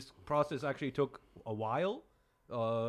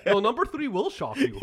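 laughing clickbait voice; 10 semitones higher, 9 dB louder than usual. Pretend. Clickbait teaser line, laughing.

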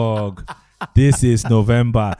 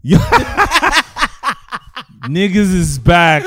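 laughing; 8 semitones higher, 5 dB louder than usual. Real, not acted. Laughing hype announcement.

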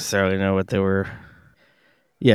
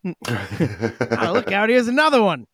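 put-on Australian accent; 8 semitones higher, 3 dB louder than usual. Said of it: "in an Australian accent".

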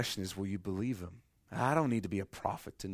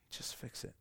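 whispered plea; 14 dB quieter than usual. Pretend. Believer's plea to God ending in a whisper. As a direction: whisper it, almost gone. Repeated demand fading to whisper.

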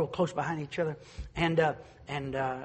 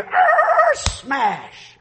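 high, loud imitated roar then smash; mock roar and smash; 13 dB louder than usual. Imitating a noise heard outside, loud mock roar. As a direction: belt out a crash noise. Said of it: "all of a sudden I hear".

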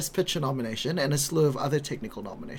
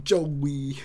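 attempted British accent. Shown as a demonstration. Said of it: "How do you say it in a British accent?".

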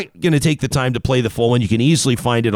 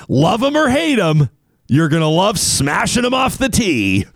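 high, excited ad-slogan shout; hyped promo slogan; 6 semitones higher than usual. Pretend. Host plugging show's golf balls, hyped slogan shout. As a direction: belt out the catchphrase, pitch soaring.

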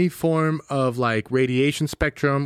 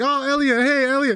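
put-on high, gushing "Oh, Elliot" from adoring girls; mock adoring squeal at the club; fawning club girls; 9 semitones higher than usual. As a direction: swoon over him like a starstruck fan. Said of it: "everyone's like".

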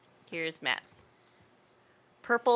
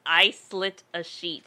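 slow careful reading; slower than usual. Shown as a demonstration. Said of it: "reading the twister slowly".

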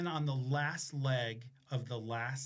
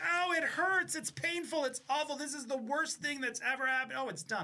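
high, hammed-up whimper of pain; mock pained whine; 10 semitones higher than usual. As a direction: whine it like it really hurts. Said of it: "You're like".

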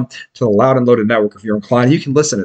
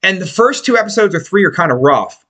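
normal talking voice

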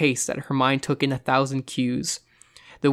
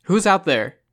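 loud, raised challenge into the dark; tense, higher demanding call; edgy shout; 5 semitones higher, 5 dB louder than usual. A narrator's reading. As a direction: shout it with an edge. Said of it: "John shouted, an edge in his voice".

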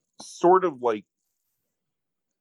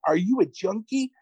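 mock screaming; 8 semitones higher than usual. Host acting out man accusing naked date, mock screaming. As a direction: imitate a hysterical accusation. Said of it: "So he starts screaming".

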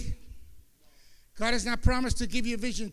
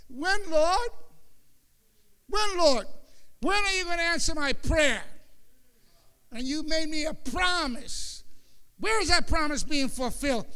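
higher, pleading questions to God; impatient prayer; 4 semitones higher than usual. Retold or imitated. Believer praying impatiently to the Lord. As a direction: nag God a little, raised. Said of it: "I know you do that in your prayer".

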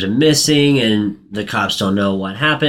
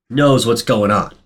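normal talking voice

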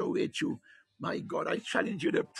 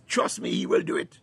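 usual speaking voice

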